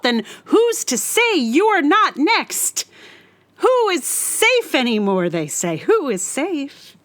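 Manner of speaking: ordinary delivery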